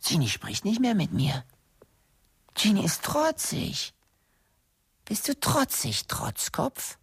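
high, singsong needling questions; teasing taunt. Acted. Taunting Jeannie for not writing back, high teasing voice. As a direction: tease her in a high-pitched voice.

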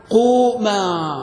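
very slow, long-held vowels; elongated, sustained grammar form; drawn-out example. Shown as a demonstration. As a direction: stretch both syllables right out. Teacher starts counting example forms.

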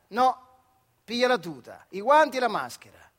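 boss's curt order. Acted. Boss snapping orders at the questioning worker, loud and curt. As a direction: bark the orders down at him.

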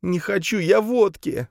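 high, slow tearful protest; sobbing. A narrator's reading. Camille refusing the gendarme's vodka, sobbing. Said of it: "всхлипнула девушка".

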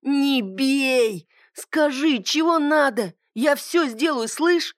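high, pleading, panicked wail; begging whine. A narrator's reading. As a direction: beg for mercy in a high wail. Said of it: "согнулся пополам и завыл".

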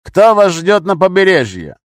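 high, slow, loud questioning; harsh demand. A narrator's reading. Gunman asking captive who waits ashore, loud demand. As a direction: demand it forcefully.

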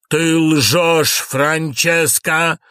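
high, loud outburst, drawn out on the name; loud accusation. Acted. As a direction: accuse her at full voice. Lorenzo accuses her of lying.

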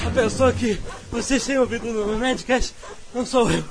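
urgent, loud hostage plea; dramatic shouting. Acted. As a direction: shout it like a captive crying for rescue. Mensagem dramática de resgate. (apelo dramático gritado)

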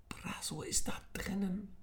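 whisper. Acted. Nick tells Emir at the storeroom door, whispering. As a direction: breathe it to your friend. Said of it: "flüsterte er Emir zu".

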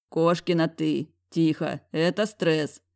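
plain reading voice

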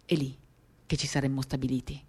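ordinary talk

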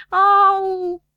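high, loud ow; yelp of pain. Acted. Dunno squealing as the doctor dabs iodine, high and loud. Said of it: "Nu mai zbiera".

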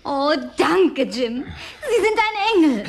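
high, gushing thanks through laughter; laughing thanks. Acted. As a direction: laugh out your thanks, delighted. Thanks Jim with a hug.

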